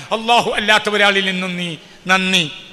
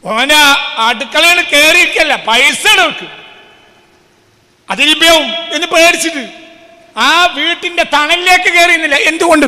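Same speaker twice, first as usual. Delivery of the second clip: preaching at full force; 10 semitones higher, 9 dB louder than usual. Acted. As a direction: drive the point home from the pulpit. (പ്രസംഗപീഠത്തിൽ നിന്ന് ഉറക്കെ, ആവേശത്തോടെ പ്രസംഗം)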